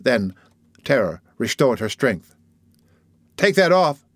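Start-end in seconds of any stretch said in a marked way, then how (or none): 3.4-4.0 a raised, angry cry, higher and louder; a frightened girl's angry cry to her torturers. A narrator's reading. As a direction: shout it at them in anger. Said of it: "she cried angrily".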